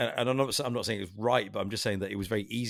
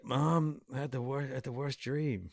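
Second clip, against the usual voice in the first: soft, higher, groggy film impression; waking Marty; 3 semitones higher, 7 dB quieter than usual. Quoting Marty waking up to his mother, groggy murmur. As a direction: come round groggily, softly calling for Mom. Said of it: "where he wakes up and he's like".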